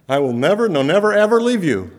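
soaring insistence on "never" and "ever"; emphatic never. Shown as a demonstration. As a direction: ring out "never, ever" with rising force. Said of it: "He wants to make sure you know".

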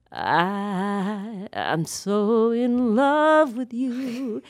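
creaky fry onset, slow; vocal fry. Shown as a demonstration. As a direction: croak into each word with vocal fry. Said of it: "So vocal fry is like lurch".

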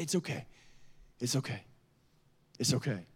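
very soft, spaced-out reassurance; consoling mother. Retold or imitated. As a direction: hush a crying child gently, imitating mom. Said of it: "or just consoles her".

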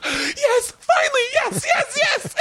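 hammed-up, sky-high squeals of joy; mock ecstatic. Woman fake-accepting a proposal in the room, hammed-up ecstasy. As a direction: imitate a wildly delighted bride-to-be.

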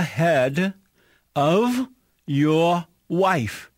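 slow, each word spaced out; slow and deliberate. Real, not acted. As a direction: spell the question out slowly. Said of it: "The question is".

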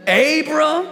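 high, loud, rhythmic pulpit delivery; full-force preaching. Real, not acted. Preacher declaring God turned wrongs to good, full-force preaching. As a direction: drive every phrase home like a fired-up preacher.

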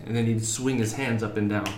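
ordinary talk

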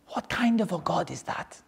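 whispered, half-voiceless and soft. Shown as a demonstration. Teacher whispering the question to the class, hushed. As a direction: hush it like a secret. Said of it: "repeated line, first whispered".

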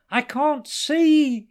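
high, drawn-out wail; desperate, stretched cry; loud wail. A narrator's reading. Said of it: "he wailed".

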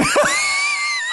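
shrieking burst of laughter; a high disbelieving laugh; incredulous high laugh. Real, not acted. Laughing at the fish dying after the cleanup, high and shrieking.

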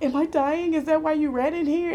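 high, loud frantic question; retold panicky plea to the doctor; panicked. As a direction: blurt it out in a panic.

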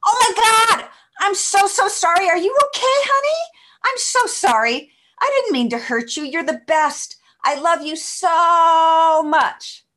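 put-on high, loud doting baby talk to a pet; gushing to the dog. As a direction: coo over the hurt dog in a squeaky pet voice. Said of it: "she said this".

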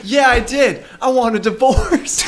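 mock defiant wife. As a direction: announce it as the fed-up wife. Acts out wife demanding divorce.

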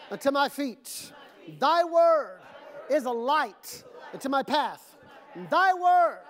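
loud, high, phrase-by-phrase recitation; shouted call and response. Acted. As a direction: lead the crowd line by line, full voice. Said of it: "Repeat this after me".

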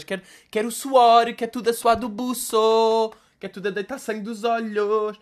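mock her fussy self-pity; mocking parody voice. Vlogger parodying an influencer: whiny high voice.

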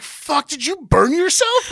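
high, loud, drawn-out shock; retold alarm. Friend asks him about a burn, high loud alarm.

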